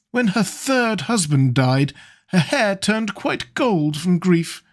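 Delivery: high, languid lord; drawling wit. A narrator's reading. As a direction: toss off the quip like a bored lord. Lord Henry's anecdote about Madame de Ferrol.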